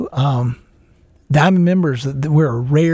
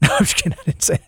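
ordinary delivery

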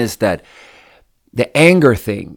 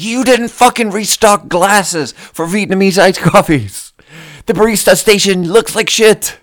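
angry rant at coworker; 9 semitones higher, 6 dB louder than usual. Retold or imitated. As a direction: chew out the coworker for not restocking. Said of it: "And I get so mad".

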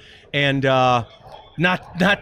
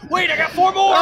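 much higher, laughing yell; laughing shout; 13 semitones higher, 4 dB louder than usual. Retold or imitated. Acting out the machine-feeding customer.

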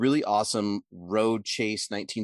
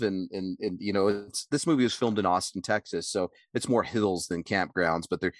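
normal talking voice

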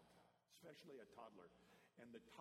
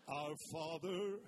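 slow booming mock-divine Our Father; God voice; 4 semitones higher, 17 dB louder, much slower than usual. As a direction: intone it like a voice from heaven. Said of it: "I got a God voice".

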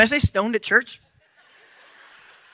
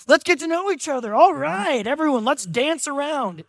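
over-excited camp guide; 7 semitones higher than usual. Retold or imitated. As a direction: pump it up like an overeager instructor.

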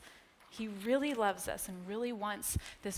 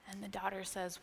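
ordinary talk, nothing marked

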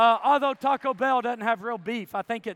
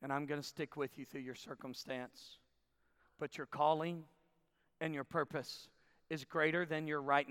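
low, soft, measured reassurance; gentle voice of God; 8 semitones lower, 10 dB quieter than usual. Retold or imitated. God telling believer to look up, gently. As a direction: speak quietly and low, as God to a listener. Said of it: "God's saying".

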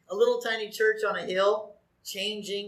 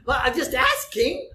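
higher, mock-innocent protest; playful innocence; 6 semitones higher, 4 dB louder than usual. Real, not acted. Preacher teasing his congregation, mock-innocent. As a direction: play innocent, a bit louder. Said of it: "teasing the congregation".

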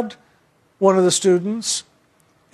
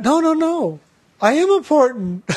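high, eager protest; a boy proudly setting her straight; higher, amused insistence; insistent child; 7 semitones higher than usual. Retold or imitated.

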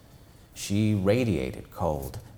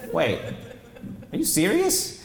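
much higher shocked question; can't believe it; high stunned question; shocked disbelief; 8 semitones higher than usual. Acted. Janet shocked.

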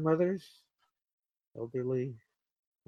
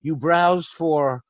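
mock interrogator; 6 dB louder than usual. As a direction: drag out each word of the accusation. CIA interrogator resumes.

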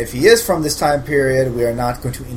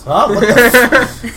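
sudden loud startled what; startled outburst; 12 semitones higher, 8 dB louder, slower than usual. Acted. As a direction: jolt awake, shocked and drawn out. Snapping out of distraction.

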